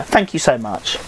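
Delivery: ordinary delivery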